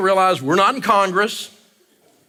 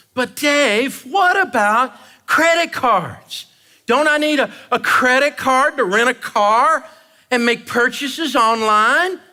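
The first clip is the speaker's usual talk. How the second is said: higher, pleading listener voice; mock caller; 5 semitones higher, slower than usual. Audience member questioning Dave, in a higher pleading voice. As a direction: ask like a worried fan.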